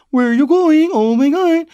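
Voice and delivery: very high, loud mock Cork accent; bad Cork accent. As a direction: squeal it in exaggerated Cork. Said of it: "doing a Cork accent, they just go".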